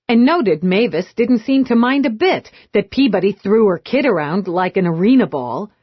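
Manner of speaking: ordinary delivery, nothing marked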